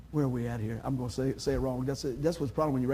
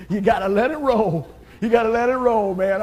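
loud laugh then laughing words; scene: pastor laughs at his own lyric blunder; 4 semitones higher, 9 dB louder than usual. Real, not acted. Laugh it off out loud. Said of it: "laughing at himself".

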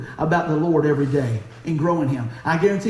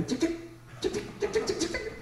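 quick, high, soft ticking noises; imitated key clicks; 12 semitones higher, 13 dB quieter than usual. Cashier punching in a discount card, soft imitated ticking. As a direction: tick rapidly like a register being keyed.

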